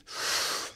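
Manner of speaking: long, audible deep breath. Shown as a demonstration. Teacher showing listeners diaphragmatic breathing, long deep breath. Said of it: "So when you deep breathe".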